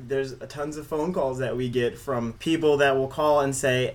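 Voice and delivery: ordinary talk, nothing marked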